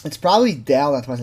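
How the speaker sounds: ordinary delivery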